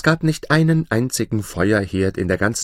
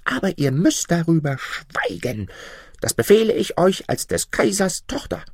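hohe, gezierte Prinzessinnenstimme; Prinzessin; 9 semitones higher than usual. Acted. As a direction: spiel die verwöhnte Prinzessin mit hoher Stimme. Princess commands. (hochgestellte, affektierte Prinzessin)

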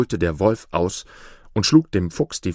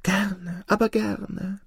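very high, hurried eager fox; 12 semitones higher, 3 dB quieter, slower than usual. A narrator's reading. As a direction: hurry to agree, over-eager. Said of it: "beeilte sich der Fuchs zu sagen". (eifriger Fuchs, sehr hoch und hastig)

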